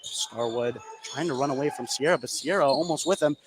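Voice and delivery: live basketball call, building as the shot goes up; play-by-play. Real, not acted. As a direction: call the action as it happens.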